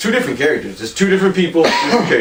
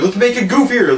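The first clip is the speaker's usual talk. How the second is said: mocking studio; 9 semitones higher than usual. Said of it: "they're like".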